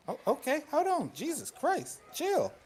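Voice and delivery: real startled high-pitched scold at a cat. Real, not acted. Player scolds his cat flipping out, high startled. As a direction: yelp it at the cat to calm down.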